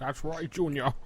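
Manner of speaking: playful Scottish-actor impression; mock-fatherly line in a Scottish slur; Sean Connery. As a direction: chime in with a celebrity impression. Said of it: "you do like Sean Connery".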